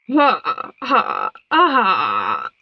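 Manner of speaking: slow, deliberate "ha, ha, aha"; villainous laugh. A narrator's reading. Frog queen gloating over the moth, laughing. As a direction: laugh in separate bursts, dropping low at the end. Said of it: "The frog queen laughed".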